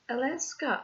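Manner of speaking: tiny, high-pitched squeak; high, small squeaky disbelief. Retold or imitated. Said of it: "in a very small, squeaky voice".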